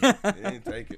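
said through high laughter; laughing. Real, not acted. Bandmate laughs while teasing the host.

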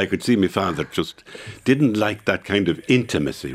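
ordinary talk, nothing marked

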